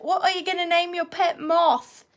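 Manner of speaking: high-pitched English mum; English mum accent. Retold or imitated. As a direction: mimic an English mother asking her little boy. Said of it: "They're from England".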